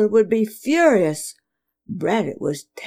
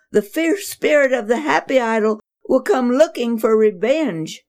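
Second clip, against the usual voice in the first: higher, distraught mother lament; wailing; 6 semitones higher than usual. Acted. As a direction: wail it in dismay. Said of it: "she wailed".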